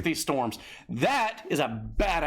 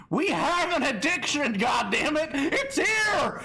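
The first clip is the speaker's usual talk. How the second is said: high shouted mock despair; hammed-up shouting; 12 semitones higher, slower than usual. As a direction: cry out like the doom is here. Hamming up the addiction bit.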